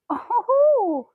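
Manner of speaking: high, loud "ooh" at the cat; long cooing squeal of delight; lifted, drawn-out coo. Real, not acted. Reacting to cat's nose rub.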